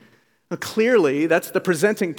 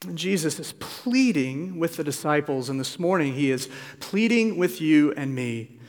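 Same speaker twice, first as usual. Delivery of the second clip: ordinary delivery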